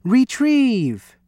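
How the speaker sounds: high, falling call; cried command. A narrator's reading. Louis sending his pet for treasure, cried command. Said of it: "cried Louis".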